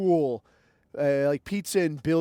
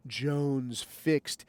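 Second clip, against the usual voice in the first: softer, low, intimate sponsor pitch; ad read; 3 semitones lower, 4 dB quieter than usual. Acted. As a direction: sell the coffee gently and close. Coffee plug read.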